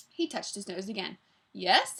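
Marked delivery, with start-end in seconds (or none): 1.5-2.0 lightly voiced pleasant Scottish gentleman; louder, pleasant Scottish "yes". A narrator's reading. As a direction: answer with a hint of Scottish. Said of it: "in the pleasant voice he took from the Scottish gentleman".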